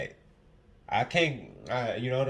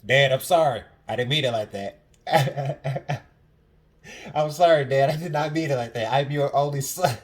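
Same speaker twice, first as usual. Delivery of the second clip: loud mock apology; 5 semitones higher, 9 dB louder than usual. Real, not acted. Loudly apologizing to his dad.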